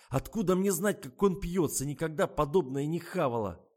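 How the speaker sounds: high, loud indignant complaint; exasperated protest. A narrator's reading. Tasya defends herself to friends, exasperated. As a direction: protest as if wrongly blamed.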